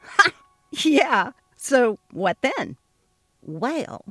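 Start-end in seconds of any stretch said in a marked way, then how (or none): none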